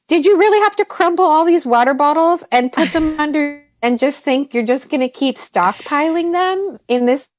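put-on high, incredulous scolding of the teens; exasperated mom. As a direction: ask it like you can't believe the mess. Mother scolding teenagers over mess.

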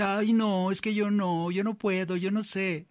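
whiny refusal. Retold or imitated. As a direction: play David as a timid kid backing out.

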